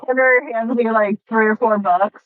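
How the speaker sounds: ordinary delivery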